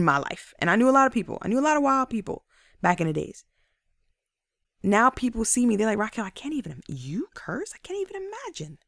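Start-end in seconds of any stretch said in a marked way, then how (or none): 6.0-8.8 hushed, incredulous gasp of a question; mock disbelief. Friends reacting to her cursing past, mock disbelief. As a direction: softly imitate someone who can't believe it.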